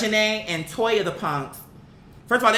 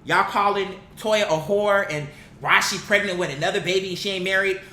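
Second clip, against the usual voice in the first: ordinary talk, nothing marked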